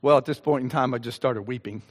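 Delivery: ordinary talk, nothing marked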